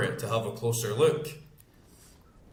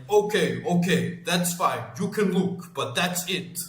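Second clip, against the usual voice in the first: higher-pitched mock grudging consent; imitated foreign man; 5 semitones higher than usual. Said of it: "And then he's like".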